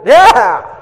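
high, loud victory yell; triumphant cheer. Retold or imitated. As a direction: cheer the catch. Triumph after catching.

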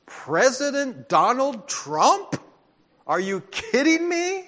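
high, loud incredulous gasp of disbelief; stunned disbelief. Retold or imitated. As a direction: replay the shock of hearing the election result. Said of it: "I was one of those just going".

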